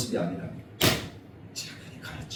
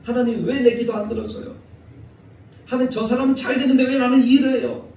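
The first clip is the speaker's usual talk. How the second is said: rising, louder complaint to God; complaining to God; 8 semitones higher, 5 dB louder than usual. Retold or imitated. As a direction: whine at God, building up. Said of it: "우리가 살아가다 보면 그럴 때".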